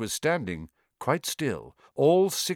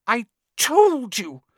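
put-on breathless high girl's voice; gasping, high and slow; 14 semitones higher, 6 dB louder, slower than usual. A narrator's reading. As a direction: gasp it out, winded. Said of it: "Hermione gasped".

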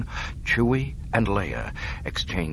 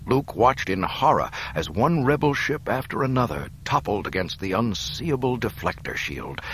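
ordinary delivery

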